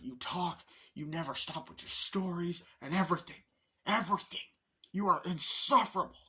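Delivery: exasperated tirade. Retold or imitated. Fed-up man attacks roommate's endless stories, building exasperation. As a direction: build to a loud final verdict. Acted quarrel, rant about stories.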